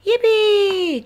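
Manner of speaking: high, loud drawn-out cheer. A narrator's reading. Peppa cheers at being tucked in, high gleeful cheer. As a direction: cheer like a delighted little pig. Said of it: "cheered Peppa".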